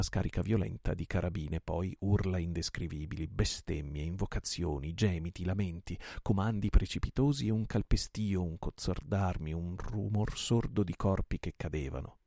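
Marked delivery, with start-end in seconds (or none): none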